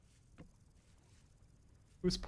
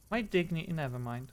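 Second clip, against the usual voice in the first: ordinary delivery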